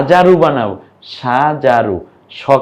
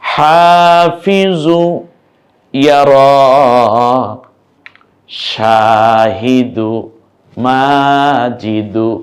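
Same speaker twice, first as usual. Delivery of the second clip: each word stretched long, syllables held, louder; slow drawn-out reading; 3 dB louder, much slower than usual. Shown as a demonstration. Teacher to class, reading the new words aloud, very slow. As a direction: read every word slowly and clearly. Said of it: "শব্দ আমরা পড়ে ফেলি".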